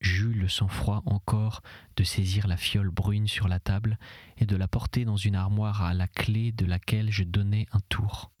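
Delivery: usual speaking voice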